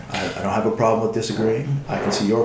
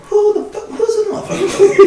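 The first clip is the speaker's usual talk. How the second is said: high put-on voice, laughing; mock outraged reading; 4 dB louder than usual. Reading his friend's rude text, high laughing mock outrage. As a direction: squeak it out in mock offence. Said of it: "reading your text going".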